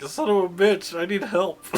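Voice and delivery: high, loud hurt cursing; laughing, hammed-up cry for help; pained plea. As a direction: beg for help over a cheering crowd. Hurt player ignored by cheering crowd.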